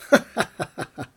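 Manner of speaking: acted chuckling burst of laughter; a long, amused character laugh; uncle's laugh. A narrator's reading. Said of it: "doch dann musste er lachen".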